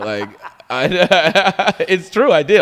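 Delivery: laughing loudly while admitting it. Real, not acted. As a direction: burst out laughing mid-confession.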